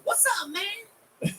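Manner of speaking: very high, slow squealed greeting; Chris Tucker voice. Shown as a demonstration. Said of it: "What about this one?".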